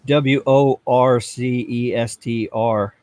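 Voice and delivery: W O R C E S T R spelled slowly, letter by letter. Shown as a demonstration. Reading a chat message, spelling slowly. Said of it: "You mean".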